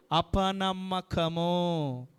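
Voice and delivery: అపనమ్మకము stretched very slowly, falling at the end; drawn-out word. Acted. Preacher naming the congregation's doubt, అపనమ్మకము drawn out slowly. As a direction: drag out అపనమ్మకము syllable by syllable. Preacher answers his own question.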